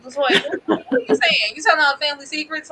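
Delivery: high, loud mock challenge; incredulous calling out. Recapper teasing Latoya in a high loud voice. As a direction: call her out, laughing.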